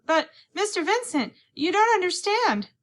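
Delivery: high, loud pleading call; calling after. A narrator's reading. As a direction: call after him as he leaves. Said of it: "She called".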